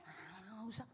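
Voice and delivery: low, very soft brush-off; reluctant refusal. Acted. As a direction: imitate a man shying from affection. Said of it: "Istrinya juga nggak bisa digandeng". (menolak pelan dengan nada rendah)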